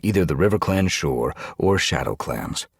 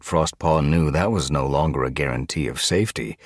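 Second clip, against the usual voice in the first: ordinary delivery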